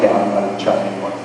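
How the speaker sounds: quiet, fast, defeated mumble; resigned and deadpan. Retold or imitated. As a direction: throw it away with a shrug. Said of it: "I'm like".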